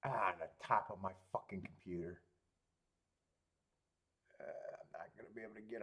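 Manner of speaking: fast annoyed whisper. Real, not acted. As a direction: hiss it under your breath.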